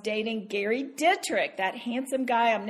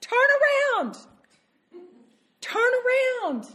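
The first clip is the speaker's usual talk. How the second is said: urgent plea; 12 semitones higher, 5 dB louder than usual. Acted. Urging sinners to repent.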